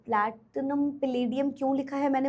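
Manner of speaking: drops the voice to answer herself. Real, not acted. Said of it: "answering the quoted question".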